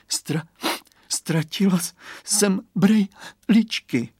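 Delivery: put-on a tearful girl who lost her glasses; sobbing. Girl sobs to friend about lost glasses, halting. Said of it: "zavzlikala Kateřinka".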